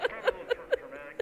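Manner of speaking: loud high laughter; laughing. Real, not acted. Laughing at his own prank.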